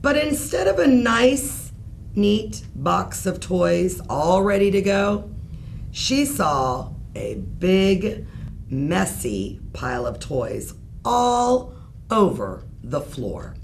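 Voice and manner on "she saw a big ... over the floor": ordinary delivery, nothing marked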